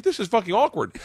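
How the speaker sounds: high, pained exasperation; awkward exasperation. Retold or imitated. Reacting to the awkward situation, high and cringing. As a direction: pitch up, cringing. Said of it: "I'm like".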